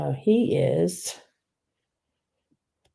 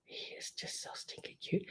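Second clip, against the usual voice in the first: breathy unvoiced whisper, much softer; 21 dB quieter than usual. Real, not acted. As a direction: hush it like a secret. Gushing over figurine, whispered take.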